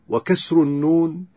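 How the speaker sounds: ordinary delivery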